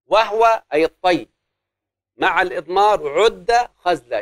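drawn-out chanting of the rule; slow teaching chant; slow verse recitation. Shown as a demonstration. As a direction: lay out each word slowly for the class. Recites mnemonic verse slowly.

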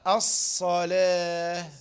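"الصلاة" very slow with a long ص; no puffing. Shown as a demonstration. Teacher shows it without blowing, drawn-out "الصلاة". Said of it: "ودون ما تنفخ برضو".

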